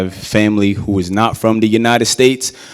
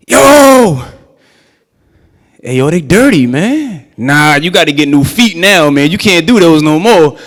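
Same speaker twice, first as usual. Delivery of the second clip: put-on teasing kids; 5 semitones higher, 6 dB louder than usual. Kids mocking dirty shoes.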